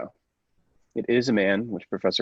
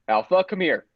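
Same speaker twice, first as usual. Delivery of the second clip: calling out; 9 semitones higher, 4 dB louder than usual. Acted. Scientist calls the robot over.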